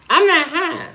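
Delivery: high-pitched protest; defensive, voice jumping up; indignant denial. Real, not acted. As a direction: deny it, affronted. Denies viewer's accusation of being high.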